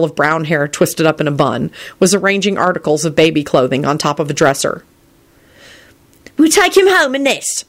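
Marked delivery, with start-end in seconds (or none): 6.4-7.6 put-on high, firm old-woman voice; firm midwife. A narrator's reading. Midwife to Elizabeth with baby clothing, firm high voice. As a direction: state it firmly, pitched well up. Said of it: "she told Elizabeth firmly".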